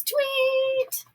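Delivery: very high, sharp whistle sound; police whistle. A narrator's reading. Michael stopping traffic, high whistle blast. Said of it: "blowing his whistle".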